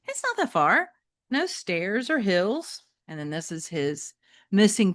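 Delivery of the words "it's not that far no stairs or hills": much higher, coaxing character voice; coaxing Metalhead voice. Acted. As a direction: tempt your friend to stay out. Metalhead persuading.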